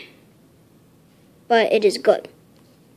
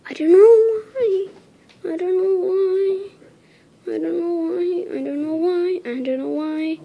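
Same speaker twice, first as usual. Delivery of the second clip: pitched up, fading away each time; high strained repeat; 6 semitones higher than usual. Real, not acted. Kid tells viewers his voice is acting up, high and fading. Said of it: "my voice is getting furious".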